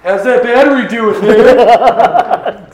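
high teasing laugh-talk. Real, not acted. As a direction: tease while cracking up.

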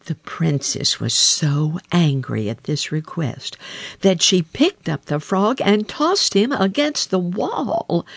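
ordinary delivery, nothing marked

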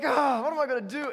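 loud, high panicked cry; despairing teen after the crash. Retold or imitated.

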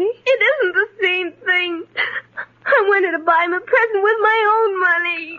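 put-on tearful childish protest; crying. Girl answering stepmother about the present, crying. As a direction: insist through the tears. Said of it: "please stop crying".

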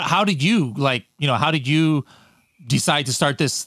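usual speaking voice

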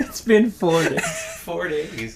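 laughing. Real, not acted. Laughing disbelief at quitting.

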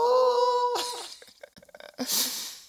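high, loud cackle; mocking laugh. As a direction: laugh at him like the crowd. Crowd laughing at him.

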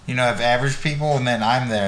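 normal talking voice

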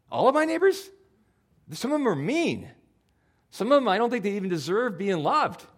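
high, indignant complaining; whiny protest. Acted. Pastor acting out arguing with God about neighbors, high whiny protest. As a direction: whine like a kid let down by the rule.

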